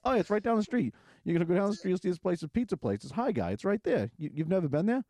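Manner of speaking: high, eager, insistent greeter voice; pushy greeter. Retold or imitated. Greeter pitching the restaurant to two kids, high and insistent. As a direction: push the restaurant hard, up high.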